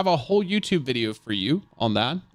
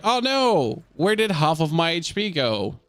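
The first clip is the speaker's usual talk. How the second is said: mock dismay; 3 semitones higher than usual. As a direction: play the baffled, stricken victim for laughs. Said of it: "how he's like".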